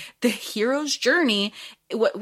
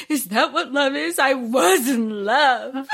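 mock sob, drawn out slow on the last line; tearful mock lament over lost love; imitating sobbing; 3 semitones higher, slower than usual. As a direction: ham up a fairy's dramatic weeping. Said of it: "but then her sobbing".

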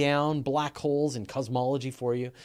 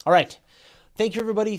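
loud; 8 semitones higher, 10 dB louder, much faster than usual. Real, not acted. Loud sign-off to audience.

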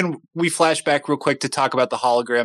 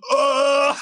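high mock groan of disgust; a short hammed-up dying ugh; 10 semitones higher than usual. Cyborg dying as the thing crashes, mock groan. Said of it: "gives this look that's like".

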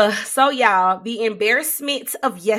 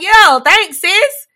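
sarcastic thanks; 9 semitones higher, 8 dB louder, much slower than usual. Retold or imitated. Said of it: "I'm like".

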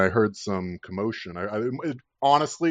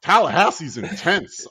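higher, laughing through the words; amused awe, half laughing; laughing disbelief; 8 semitones higher than usual. Retold or imitated. Recalling meeting his roommate, laughing in disbelief. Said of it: "And I'm like".

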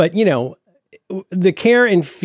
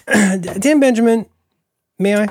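slow, higher mock call-in voice; radio caller; 8 semitones higher, 3 dB louder than usual. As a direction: play a caller asking to speak. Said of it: "go ahead, caller".

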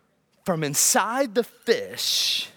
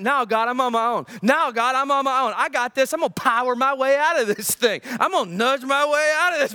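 higher-pitched, lightly acted defiance; stubborn Jonah; 6 semitones higher, 3 dB louder than usual. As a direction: push back at God as stubborn Jonah. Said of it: "Jonah's like".

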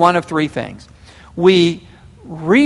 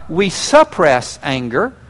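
normal talking voice

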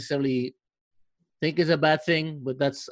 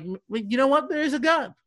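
amazed realization; 10 semitones higher than usual. Retold or imitated. Sudden realization retold.

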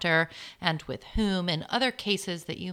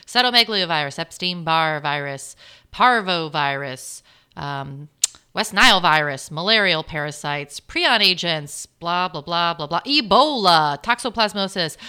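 loud listing; 8 dB louder than usual. Real, not acted. Gleefully rattling off scary pathogens.

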